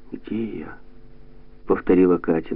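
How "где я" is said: very soft, weak question; small hushed voice; faint, dazed. A narrator's reading. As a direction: ask it feebly, just coming round. Dazed girl repeats her question.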